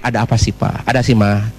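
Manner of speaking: low-pitched tender enquiry; comforting husband. Acted. Husband asks crying wife what is wrong, low and tender. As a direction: ask gently in a low, acted husband voice. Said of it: "Terus eye contact".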